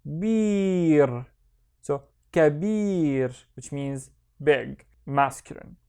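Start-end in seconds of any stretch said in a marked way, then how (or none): 0.0-1.2 BIR drawn out on its own; long single-syllable demo of "bir"; isolated syllable. Shown as a demonstration. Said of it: "The second syllable is". 2.3-3.3 KABIR slow, with a long second syllable; slow model reading of "kabir"; syllables joined. Shown as a demonstration. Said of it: "I will put them together".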